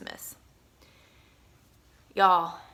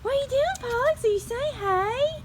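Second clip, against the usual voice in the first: much higher, sweet pet-talk voice; talking to the dog. Real, not acted. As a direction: coo it to a scared puppy. Owner talks to shy dog.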